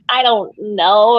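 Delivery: slow, stretched-out "I don't know"; drawn-out unsure. Retold or imitated. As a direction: drag it out, mock clueless. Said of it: "just being like".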